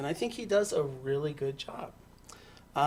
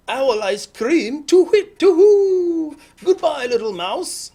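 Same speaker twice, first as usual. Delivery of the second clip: very loud, high owl hoots; alarmed hooting owl; 11 semitones higher, 13 dB louder, slower than usual. Acted. Owl flees the mouse, hooting loud and high.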